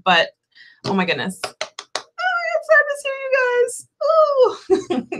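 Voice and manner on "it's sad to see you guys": high, slow words through laughter; real laughter at the viewers; laughing. Real, not acted. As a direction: giggle the words out.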